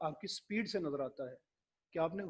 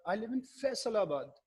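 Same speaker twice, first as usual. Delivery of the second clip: "Faisalabad" stressed, higher and louder, slow; stress on Faisalabad; 4 semitones higher, 4 dB louder, slower than usual. Shown as a demonstration. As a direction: land hard on "Faisalabad". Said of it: "Faisalabad को इस तरह stress किया".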